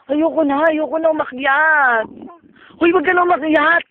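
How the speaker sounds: frightened, loud pleading refusal; scared yelling. Real, not acted. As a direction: yell it out in a panic. Scared refusing to climb higher. (takot na sumisigaw)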